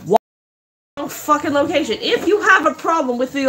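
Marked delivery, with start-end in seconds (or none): 0.1-2.0 bitter laugh. Real, not acted. Bitter laugh during rant.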